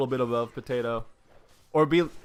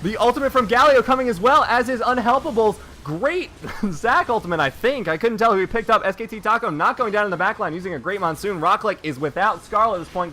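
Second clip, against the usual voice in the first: higher, louder live casting of a teamfight; play-by-play; 9 semitones higher, 7 dB louder than usual. Real, not acted. Caster calling the big teamfight, excited play-by-play. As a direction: call the fight fast and hyped.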